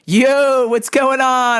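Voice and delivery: loud, high, drawn-out holler; calling out. Retold or imitated. Choked grappler greeting people walking by, loud carefree call. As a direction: hail passers-by at the top of the voice.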